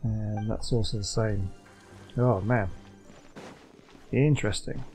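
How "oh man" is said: ordinary talk, nothing marked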